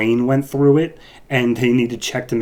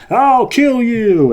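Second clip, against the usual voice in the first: much higher, louder, stretched-out threat; mock furious yell; 13 semitones higher, 7 dB louder, much slower than usual. [name] roaring at Mob Rule after hearing Barry was shot, mock furious yell. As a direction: bellow the threat, hammed up.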